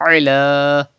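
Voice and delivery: "Ala" opened from mid-throat; throaty Ain. Shown as a demonstration. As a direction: squeeze the Ain at the start of "Ala". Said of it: "Pronounce Ain from the middle part".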